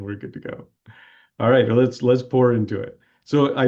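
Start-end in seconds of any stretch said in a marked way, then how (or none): 0.0-0.7 murmured softly to himself; quiet. Real, not acted. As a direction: say it under your breath. Repeats phrase quieter to himself.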